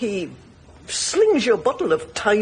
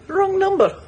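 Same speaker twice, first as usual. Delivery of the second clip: quoting herself; 8 semitones higher than usual. Retold or imitated. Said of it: "I said".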